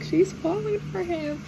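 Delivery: high, drawn-out swooning delight; gleeful gushing. Real, not acted. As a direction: stretch it out high, like a fangirl. Gleefully repeating the romantic development.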